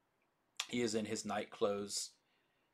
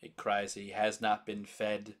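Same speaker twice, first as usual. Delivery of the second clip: usual speaking voice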